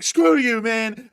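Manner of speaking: high, loud mock insult; hammed-up defiance; defiant yell. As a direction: sneer at the cop. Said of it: "they'll be like".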